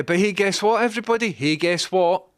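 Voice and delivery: high, loud call to everybody; rally the viewers with a teasing hey; excited shout to the audience. Real, not acted.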